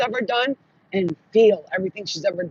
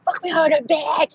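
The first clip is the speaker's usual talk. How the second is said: high-pitched goading; mock-lustful high taunt; taunting cry; 7 semitones higher than usual. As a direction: egg the witch on, voice raised high. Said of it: "and said".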